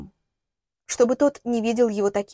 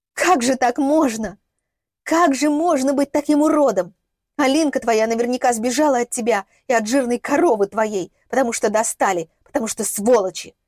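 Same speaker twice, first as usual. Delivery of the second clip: raised, outraged scolding; indignant tirade; 2 semitones higher, 4 dB louder than usual. Acted. Woman berates the man who hit her, indignant tirade. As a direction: berate him with wounded fury.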